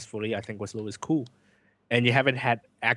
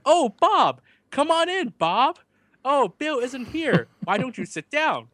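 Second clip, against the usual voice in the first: high, slow, syrupy over-explaining host; mock soap host; 13 semitones higher, 4 dB louder, slower than usual. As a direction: play a stilted TV drama host greeting a guest. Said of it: "He's like".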